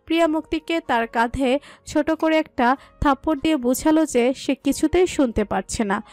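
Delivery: plain reading voice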